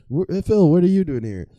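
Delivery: hammed-up startled high voice; surprised interviewer. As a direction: pitch up in mock shock at seeing Phil. Shocked interviewer spots Phil.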